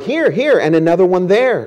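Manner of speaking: high, distressed wailing. Acted. Corrie wails to Betsy at the fleas. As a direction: point them out in rising dismay. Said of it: "Corrie wailed".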